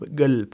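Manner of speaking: "गल्ब" with a clear g, not k; careful g sound. Shown as a demonstration. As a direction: stress the g in "गल्ब". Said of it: "कल्बी नहीं कहना". ("गल्ब" साफ़ ग की आवाज़ के साथ)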